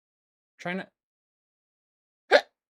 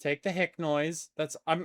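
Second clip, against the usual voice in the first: normal talking voice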